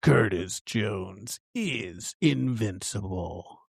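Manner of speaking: slow, drawn-out hero introduction; mock dramatic announcer. Host introducing guest judge, mock-epic announcer. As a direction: proclaim it slowly like a theme tagline.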